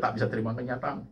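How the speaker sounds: ordinary talk, nothing marked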